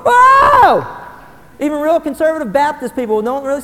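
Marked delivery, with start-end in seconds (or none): 0.0-0.8 high shouted whoa of wonder; loud, high cry of awe; amazed whoa. Pretend. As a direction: belt out a stunned whoa. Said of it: "a whole bunch of people going".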